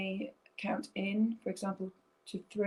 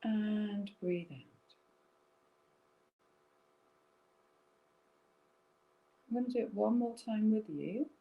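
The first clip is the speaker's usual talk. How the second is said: guided breathing meditation; 2 semitones lower than usual. Acted. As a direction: lead them gently through each breath.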